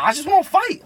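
high, loud eager outburst; hammed-up schoolkid demand; mock whiny demand. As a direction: insist like a worked-up kid. Imitates classmate eager to fight.

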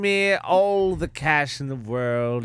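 drawn-out plea for money; slow greedy plea. Acted. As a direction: slowly ham up the greed. Begging for cash.

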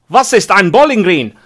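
put-on loud, high German accent quote. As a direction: ham up a German tourist asking. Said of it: "apologies for the dodgy accents".

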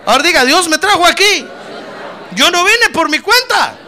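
loud, emphatic preacher's call for repetition; rousing declaration. Real, not acted. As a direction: lead the congregation phrase by phrase, forcefully. Leads congregation in shouted declaration. (declaración de fe enérgica, a viva voz)

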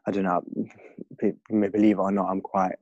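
ordinary delivery

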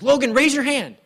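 high, loud retold command from the coach dad; loud fatherly command; dad's sharp order. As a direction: bark it like a dad coaching from the bench. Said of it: "and he says".

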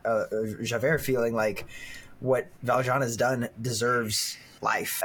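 usual speaking voice

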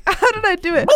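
ordinary talk, nothing marked

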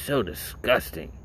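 slow, prissy put-on old lady voice; imitating the old lady. Mimicking the old lady outside the mall, slow disgusted voice. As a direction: sniff it out like a disgusted old woman. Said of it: "she goes".

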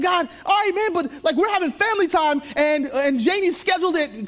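high, loud exasperated whine; grumbling at God during family dinner, high and loud; reluctant protest to God. Retold or imitated. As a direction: plead with God not now, it's family time. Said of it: "I'm like".

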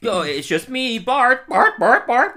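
high, loud impression of a weirdly acting boy; loud, high-pitched oddball outburst; acting weird Bart. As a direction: blurt it high and loud, climbing on the repeats. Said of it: "he's immediately acting very weird".

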